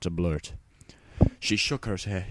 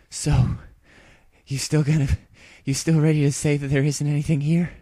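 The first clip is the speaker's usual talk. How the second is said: breathless teasing; 8 semitones higher, 6 dB louder than usual. Acted. Rainbow Dash teasing, out of breath.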